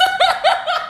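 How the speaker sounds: high giggling burst; crowd laughter. Real, not acted. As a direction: giggle uncontrollably. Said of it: "no entiendo de qué se ríen".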